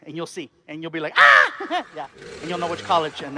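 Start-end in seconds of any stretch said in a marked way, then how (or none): 1.2-1.9 short, high, loud "ah"; mock shocked cry. Audience member googles the college, mock shocked cry. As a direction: yelp as if stunned by a discovery. Imagined reaction on finding the answer.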